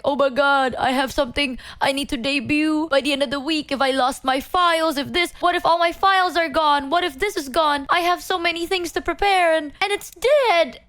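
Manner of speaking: retelling her own panic over a dead PC, half-acted; retold panic. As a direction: replay the rising panic lightly. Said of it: "I was panicking a little bit".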